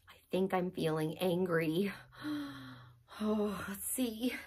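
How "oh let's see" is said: whispered to self; soft unvoiced aside. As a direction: think it through quietly. Thinking aside.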